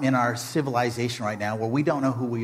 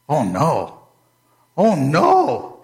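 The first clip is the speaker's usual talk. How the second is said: dismayed; 3 semitones higher, 5 dB louder, much slower than usual. Acted. Haman realizes doom.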